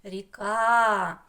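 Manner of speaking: "Ріка" with КА stretched and loud; teacher's demo: рі-КААА; stress on second syllable. Shown as a demonstration. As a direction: lean hard on the final "а" of "Ріка". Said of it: "На другий склад ставимо наголос".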